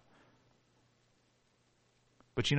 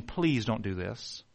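quiet pleading; 4 semitones lower, 7 dB quieter, slower than usual. Acted. Family begging him not to shoot.

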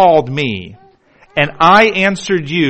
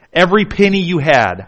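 ordinary delivery

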